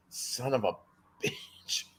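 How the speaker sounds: laughing whispered curse; whispering. Real, not acted. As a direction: hiss it under your breath. Frustrated aside under breath.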